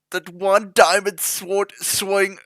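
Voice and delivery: ordinary talk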